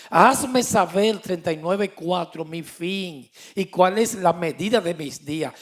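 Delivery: loud, fervent pulpit voice; fervent preaching. Acted. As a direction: thunder the message to the congregation. Preaching at full force. (predicando con fuerza desde el púlpito)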